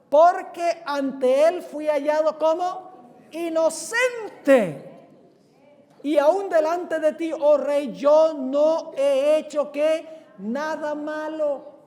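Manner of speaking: high, loud, slow proclamation; preaching at full force. Acted. Preacher voices Daniel's answer to the king, preaching at full force. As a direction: ring out every word, pausing to prompt with "qué".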